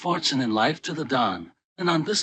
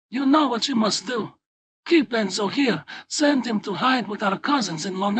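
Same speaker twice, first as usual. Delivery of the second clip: a higher-pitched, firm mother's voice; lightly raised voice of a bossy Italian wife; scolding wife; 7 semitones higher than usual. Acted. Filomena voiced, giving orders.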